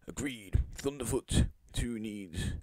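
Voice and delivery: usual speaking voice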